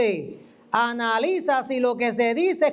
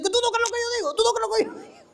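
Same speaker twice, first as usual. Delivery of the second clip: much higher, mock indignant prophet; 10 semitones higher, much faster than usual. Prophet confronting the doubting speaker, mock high indignation. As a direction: ape the offended prophet's challenge. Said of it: "me dice".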